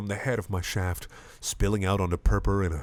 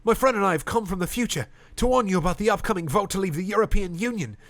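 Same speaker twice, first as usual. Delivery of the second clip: urgent warning; 11 semitones higher than usual. Acted. Addressing the pub crowd.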